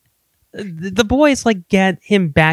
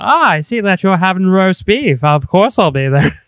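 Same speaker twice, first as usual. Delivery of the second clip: posh character voice; 3 semitones higher, 4 dB louder than usual. Retold or imitated. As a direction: play it grand and self-satisfied. Mocking Oliver Platt's character.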